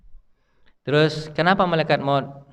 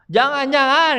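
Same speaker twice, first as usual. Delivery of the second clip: a high cry of the earth begging; the earth pleads with Israel; pleading earth; 10 semitones higher, 3 dB louder, slower than usual. Retold or imitated. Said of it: "kata bumi". (bumi memohon dengan suara tinggi)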